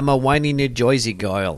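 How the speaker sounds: put-on whiny New Jersey accent; lightly put-on New Jersey girl accent. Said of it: "a New Jersey accent as best as possible".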